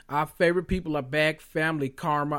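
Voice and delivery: ordinary talk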